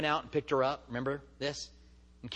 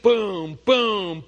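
imitated footsteps; 3 semitones higher, 8 dB louder than usual. Feet running past the fallen runner.

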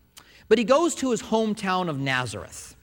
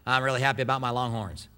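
imitated Maine accent, lower pitched; northeastern US accent; 6 semitones lower, 3 dB quieter than usual. Preacher quoting his friend from Maine, northeastern accent. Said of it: "He's got that northeastern accent".

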